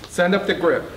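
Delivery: loud call up to the group; yelling. A narrator's reading. Captain yelling for the grip, loud call. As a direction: shout the order up. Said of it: "the captain yelled".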